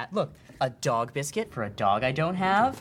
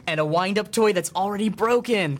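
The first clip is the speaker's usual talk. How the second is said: normal talking voice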